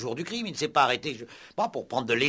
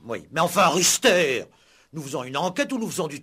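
scolding; 3 semitones higher, 7 dB louder than usual. Acted. Commissaire scolds his inspector.

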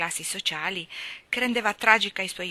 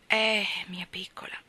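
put-on broken father answering his little girl; trembling voice. A narrator's reading. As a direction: let the voice shake with grief. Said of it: "anche la voce gli tremava".

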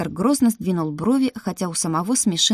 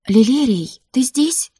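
mildly scared, higher-pitched question; frightened; 6 semitones higher, 4 dB louder, slower than usual. A narrator's reading. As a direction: call out anxiously in thought. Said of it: "испуганно подумала я". (испуганно, голос выше)

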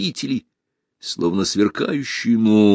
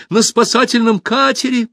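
high, loud shout of alarm; 6 dB louder than usual. A narrator's reading. Pursuer bursting into the hall with news, high shout. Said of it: "закричал он".

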